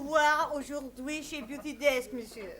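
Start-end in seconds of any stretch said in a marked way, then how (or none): none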